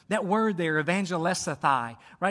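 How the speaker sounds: ordinary talk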